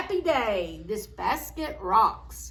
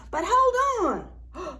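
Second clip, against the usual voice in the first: excited; 7 semitones higher, 5 dB louder than usual. A narrator's reading. Suspense cue in story.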